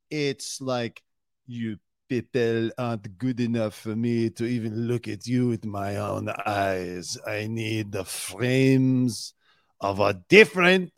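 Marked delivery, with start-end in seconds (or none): 1.5-10.9 put-on snooty French accent, drawn out. Mocking the designer's sunglasses in a haughty French accent. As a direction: sniff at everyone in a mock French accent. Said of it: "I was doing French at first".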